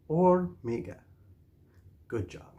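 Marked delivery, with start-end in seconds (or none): 0.1-1.0 say-along model. Shown as a demonstration. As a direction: stretch "Hormiga" so learners can join in. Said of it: "Say it with me now".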